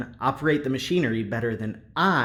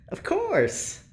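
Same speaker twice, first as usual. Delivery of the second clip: sarcastic amusement; 10 semitones higher, much slower than usual. Acted.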